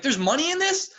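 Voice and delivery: high, loud disbelieving question; re-enacted wide-eyed shock; astonished. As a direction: squeak it out in amazement. Retelling astonishment at prize money.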